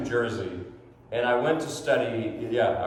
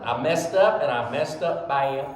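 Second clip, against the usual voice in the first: lightly imitated Southern drawl, a little laughing; a hint of a Southern good-old-boy drawl; sheepish Southern driver, lightly voiced; Larry the Cable Guy drawl; 2 semitones higher than usual. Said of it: "and he talked like that".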